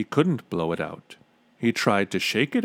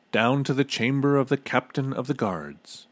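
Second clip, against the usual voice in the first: ordinary delivery, nothing marked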